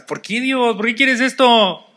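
high, loud mock wail; anguished cry to God. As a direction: cry it out to heaven.

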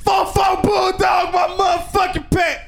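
high, slow mock yell; mock shout. Mocking [name]'s tight pants, loud mock yell. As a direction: holler it like a stunned fan. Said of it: "I'm like".

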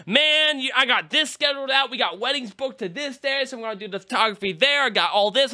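high, bragging buddy voice; boastful friend. Retold or imitated. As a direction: brag about a full schedule. Friend boasting, much higher.

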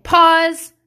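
high, loud one-word command; calling for a pause. Retold or imitated. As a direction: call it out over the kids. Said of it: "So lately I've just been saying".

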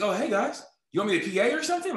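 quick, high and eager, rushing the question; a kid hoping for any job, talking fast and high. Retold or imitated. Answering the producers' call, hoping for work, eager and quick. Retold eager phone reply.

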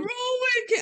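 high mock urging; lightly voiced, pleading sidekick; Ron's plea. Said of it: "And Ron says".

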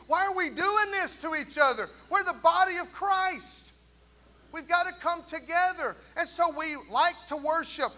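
loud, high impassioned preaching; rally the church to unity, building to a climax; full-force preaching. Acted.

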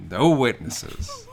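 high, loud, drawn-out gunman's cry; mock killer's threat. Gunman repeating his threat in the shop, mock menacing. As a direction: stretch it out as a hammed-up killer. Acting gunman shooting the customers.

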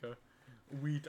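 ordinary talk

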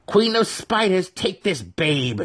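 very high, slow chanting priestess; ritual invocation. A narrator's reading. Brisa offering the baby in ritual, high solemn invocation. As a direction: intone to the Spider Queen. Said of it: "she began".

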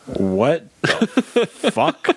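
slow, drawn-out laugh through the words; stunned laughing disbelief. Retold or imitated. At the grandfather's door, stunned and laughing. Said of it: "And I was like".

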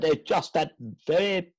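strange posh Scottish accent. Shown as a demonstration. Friend shows the actor's strange accent, posh Scottish. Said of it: "it's a strange accent".

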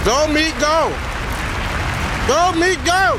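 excited cheering. Real, not acted. Cheering [name] on.

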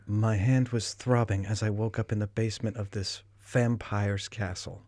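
slow, soft erotic narration; audiobook narration. Shown as a demonstration. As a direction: read it like a sultry audiobook. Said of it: "give me a line from the book".

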